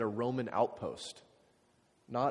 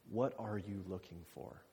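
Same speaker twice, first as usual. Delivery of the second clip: soft, intimate question; 3 semitones lower, 9 dB quieter than usual. Shown as a demonstration. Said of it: "repeats question softer".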